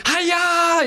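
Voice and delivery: very high, loud Uncle Roger cry; Uncle Roger Hiya. Retold or imitated. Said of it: "like Uncle Roger".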